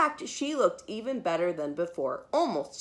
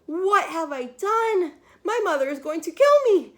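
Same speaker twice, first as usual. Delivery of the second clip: put-on panicked girl; 9 semitones higher, 6 dB louder than usual. Bridget at the mirror, panicking about her mother. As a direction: gasp at the mess, then panic. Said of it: "Bridget looked in the mirror and said".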